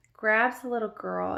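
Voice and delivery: ordinary talk, nothing marked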